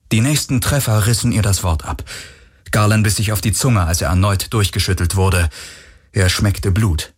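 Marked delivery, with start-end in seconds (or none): none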